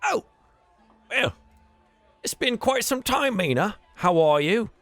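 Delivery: high, loud, friendly brawler greeting; Yagra's voice. Acted. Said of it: "she turns and says".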